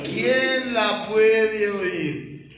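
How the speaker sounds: stretched, deliberate question; very slow quote. Shown as a demonstration. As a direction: let the question hang slowly. Pastor quotes the disciples, dragging it out.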